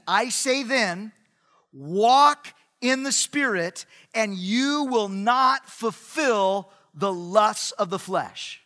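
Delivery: slow, weighty reading with loud key words; emphatic scripture reading. Real, not acted. Preacher reads Paul's verse to the congregation, slow and emphatic. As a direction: stretch each phrase out for emphasis.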